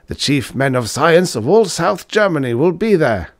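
high and loud, eager to make amends; flustered old professor. Acted. Professor anxiously offering his pupil compensation. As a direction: hurry to appease him. Professor praises and instructs Fritz.

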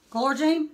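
"Gloria Jean" clipped to "Glor-Jean"; dropped syllable. Retold or imitated. Mama warning her daughter, "Gloria Jean" said as "Glor-Jean". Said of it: "She throws the I and the A away".

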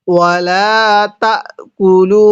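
তাকুলু with the লাম stretched three alif long, slow; long madd recitation. Shown as a demonstration. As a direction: draw out তাকুলু slowly. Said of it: "এরকম ভাবে টান দিব।".

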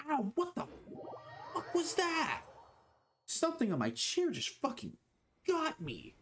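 high, jolted cries of sudden pain; startled yelp. Real, not acted. Streamer jolted by something on his chair, startled high yelps. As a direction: caught off guard by a sharp sting.

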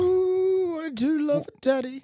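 high camp cooing; campy drag queen. Acted. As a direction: purr it like a diva. Camp coo, higher.

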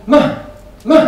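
loud, high scolding hush; sharp rebuke. Retold or imitated. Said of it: "يزجرونه".

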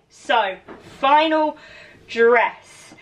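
ordinary talk, nothing marked

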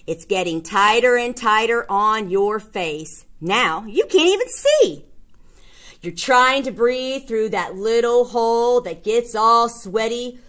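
ordinary delivery

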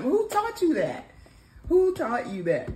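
loud, high, drawn-out disbelief; mock outrage. Real, not acted. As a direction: playfully demand an answer, slow and high. Playfully scolds his chin touching.

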